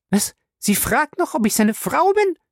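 hohe, empörte Frauenstimme; ungläubig aufbrausend; fährt los. A narrator's reading. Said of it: "fuhr Fay los". (Fay fährt die Rivalin empört an)

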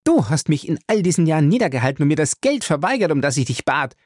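unleash years of resentment; bitter rage. Acted. Ray accuses father angrily. (verbittert wütend zum Vater)

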